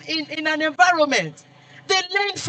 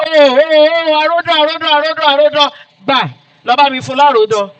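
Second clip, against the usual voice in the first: senior calling out; 5 dB louder, faster than usual. Retold or imitated. Senior teasing a junior on an errand, loud mock call. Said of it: "he will say".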